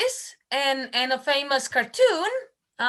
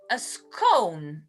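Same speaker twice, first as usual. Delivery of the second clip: normal talking voice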